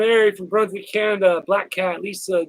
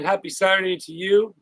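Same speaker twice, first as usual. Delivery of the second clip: usual speaking voice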